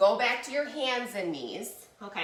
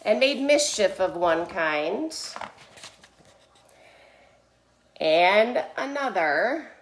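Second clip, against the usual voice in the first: put-on slow, emphatic storytelling to children; measured, expressive read-aloud; picture-book narration; 6 dB louder than usual. A narrator's reading. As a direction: read it like a storyteller, stretching key words.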